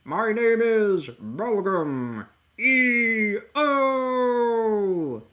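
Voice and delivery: high, loud, very drawn-out cartoon voice; over-the-top yelled introduction, every vowel stretched; Belgum E O. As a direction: announce yourself like a hammy villain. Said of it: "I'll just be doing the voice".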